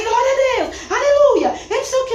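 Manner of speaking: high, loud cries of hallelujah; a churchgoer yelling glory by the pool; shouting praise. Retold or imitated. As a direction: belt out praise.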